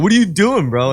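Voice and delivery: imitating a bro pushing bigger bumps; mock coke bro. Friends at a party scoffing at small bumps. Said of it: "people were like".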